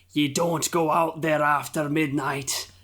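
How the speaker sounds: slow, drawn-out seafarer's warning; old sailor impression. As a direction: warn them off like a mock harbinger of doom. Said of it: "you could picture this guy being like".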